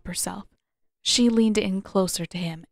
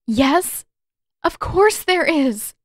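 tearful outburst; 8 semitones higher, 6 dB louder, slower than usual. A narrator's reading. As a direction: voice cracking up high and louder. Katara near tears, bursting out.

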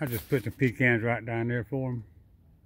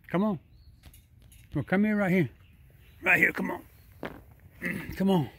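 higher, sing-song coaxing call; coaxing call to squirrels; 5 semitones higher than usual. Real, not acted. Man in his yard calling squirrels over, high coaxing call. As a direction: sweet-talk a shy animal closer.

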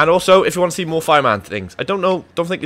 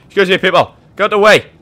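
louder, higher call to clear the way; 5 semitones higher, 7 dB louder than usual. Real, not acted. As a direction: shout at the crowd to move. Pushing through crowd.

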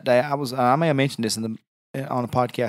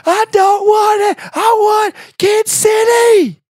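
put-on very high, loud, slow screaming kid; toddler tantrum; 8 dB louder, much slower than usual. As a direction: scream like a three-year-old in a tantrum. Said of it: "stopped screaming at me".

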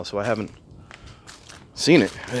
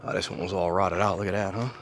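usual speaking voice

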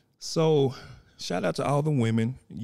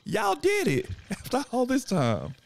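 much higher, laughing cheer; playful high congratulation; congratulating. Acted. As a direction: cheer them on, beaming. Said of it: "and just say".

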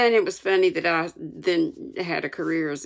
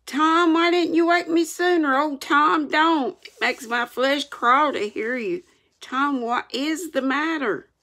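put-on high, distressed boy; urgent, high-pitched worry; frightened pleading; 10 semitones higher, 3 dB louder than usual. A narrator's reading. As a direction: plead in mounting alarm. Sid frightened by the groans.

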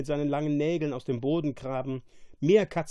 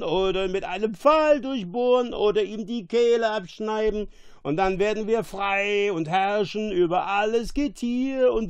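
high, raised voice with a heavy tongue; roaring drunk monster; 8 semitones higher, 4 dB louder than usual. A narrator's reading. Monster plotting his master's murder, drunken roaring. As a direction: rant like a drunken creature. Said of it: "und er brüllte".

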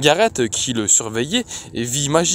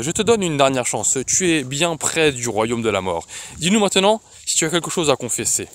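ordinary delivery

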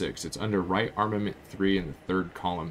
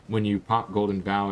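ordinary delivery

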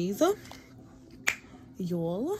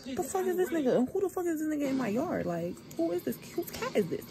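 higher-pitched, unshouted indignation; retold pissed off; 6 semitones higher, 4 dB quieter than usual. Owner spots strange cat in the yard, retold annoyance. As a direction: imitate being ticked off at an intruder. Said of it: "just get pissed off".

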